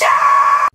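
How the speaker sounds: very high, loud shriek; shrill scream of shock. Acted. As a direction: scream when you find your hands gone. Shock at missing hands.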